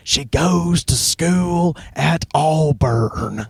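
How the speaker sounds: very slow, exaggerated Southern accent; bad Southern accent. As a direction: drawl it like a bad Southern impression. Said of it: "in his worst Southern accent going".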